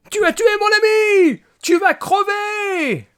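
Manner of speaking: hammed-up rage; furious shout. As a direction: scream it at the robot. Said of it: "Je hurle".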